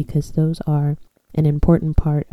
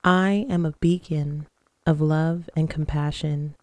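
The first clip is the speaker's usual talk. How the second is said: guided meditation. Acted. Leads guided breathing and affirmations.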